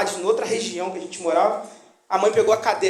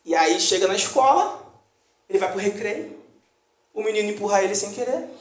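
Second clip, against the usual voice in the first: ordinary talk, nothing marked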